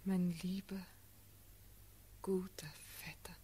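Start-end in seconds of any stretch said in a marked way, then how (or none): 2.2-3.4 very soft, breathy affection; tender murmur. Acted. Woman to her cousin and suitor, tender murmur. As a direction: let it out softly and intimately.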